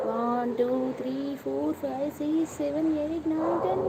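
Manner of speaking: a rising, teasing countdown to ten; playful count. Pretend.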